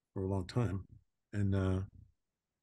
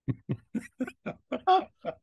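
high burst of laughter. Real, not acted. Laughs at the quip.

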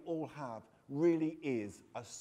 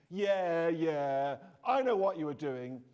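louder sneering voice, a touch hammed; mock angry sneer; 7 dB louder, slower than usual. Boy mocking his stepbrother in their shared bedroom, louder sneer. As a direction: jeer at him, louder.

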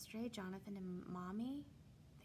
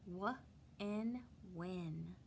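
normal talking voice

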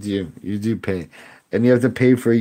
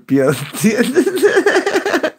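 high laugh; 4 dB louder than usual. Real, not acted. Laughing at an error card, high squealing laughter.

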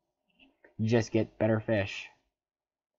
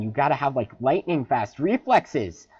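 ordinary delivery

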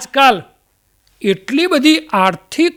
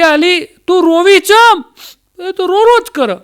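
high, slow mock mother-in-law; 8 semitones higher, 3 dB louder than usual. Mother-in-law scolding her new daughter-in-law, high and slow. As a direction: scold the crying bride as an old mother-in-law.

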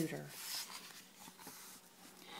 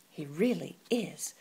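whispered aside; whispered thought. Acted. Martina privately mocking the pig, whispered. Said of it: "thought Martina".

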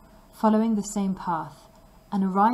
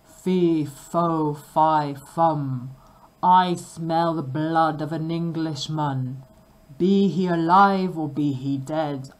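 roaring ogre; 2 semitones lower, 5 dB louder, slower than usual. A narrator's reading. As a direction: boom it out like a giant.

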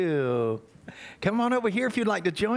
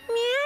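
cat meow. Pretend. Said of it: "what's a kitty cat sound like?".

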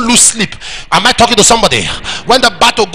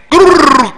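loud rolled growl, high and falling; imitated bottle noise; 6 semitones higher, 6 dB louder than usual. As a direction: roll out a sudden growling noise.

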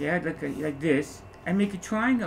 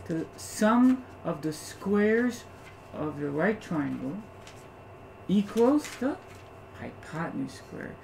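slow emphasis; slower than usual. Shown as a demonstration. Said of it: "teacher spelling out the theorem".

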